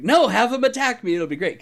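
high, sing-song ditzy innocence; ditzy act. Retold or imitated. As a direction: play the airhead to bait the DM. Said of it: "acting super ditzy".

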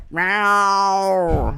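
high loud cat meow; shrill-pitched cat cry. Retold or imitated. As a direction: mew like a demanding cat. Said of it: "does the regular cat thing".

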